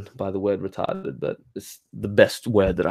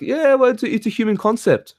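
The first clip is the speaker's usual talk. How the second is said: much higher, dismissive mock voice; mocking retort; 10 semitones higher than usual. Mocking what the spiritual crowd answers, in a high sneering voice. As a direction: parody the smug reply, pitched way up.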